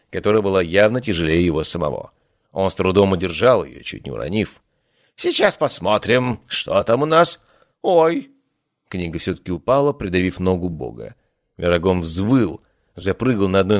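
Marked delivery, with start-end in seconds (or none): 5.2-8.3 high fussy god, yelping at the end; vain god voice. Acted. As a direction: struggle with the book, then yelp. God with heavy book.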